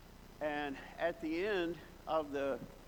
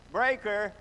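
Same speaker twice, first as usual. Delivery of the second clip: called out louder and higher; mock yell; 5 semitones higher, 9 dB louder than usual. Camper to the breaker panel at night, mock yell. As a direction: call it out to the whole bunkhouse. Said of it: "somebody would yell out".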